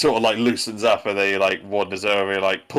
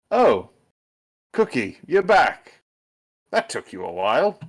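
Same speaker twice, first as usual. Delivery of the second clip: lightly voiced, unbothered old friend, higher; calm Schubert; 7 semitones higher than usual. Schubert calmly greeting the returning Cookie, lightly voiced. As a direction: greet him as if nothing happened. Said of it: "calmly looks up and is like".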